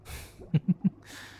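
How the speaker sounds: chuckle, pleased with your own power; gloating chuckle. Acted. Villain controls the hero's thoughts, self-satisfied chuckle.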